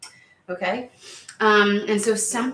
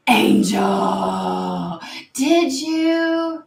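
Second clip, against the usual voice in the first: loud, drawn-out theatrical exclamation; mock shock; 4 semitones higher, 6 dB louder, much slower than usual. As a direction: cry out in mock shock, voice jumping high. Theatrical call to Angel.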